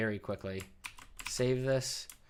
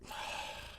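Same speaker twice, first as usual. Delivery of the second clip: grunt; 10 dB quieter than usual. Real, not acted. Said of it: "I'm grunting at myself".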